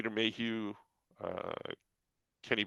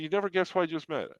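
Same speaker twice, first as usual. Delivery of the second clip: eager teasing; 8 semitones higher, faster than usual. Retold or imitated. Teasing friend with the news.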